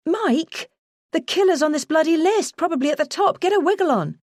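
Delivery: high, exasperated plea to the boss; urgent pressing. Retold or imitated. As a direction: hurry the boss along, exasperated. Urging the boss to hurry.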